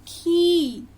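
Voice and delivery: खी said loud with a long ई. Shown as a demonstration. Teacher to children, stretching खी loud and long. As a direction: hold the ई in खी, loud. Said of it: "उसका उच्चारण लंबा और तेज होता है".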